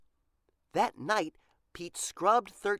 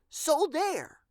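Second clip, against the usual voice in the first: bold; 12 semitones higher than usual. A narrator's reading. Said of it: "boldly added".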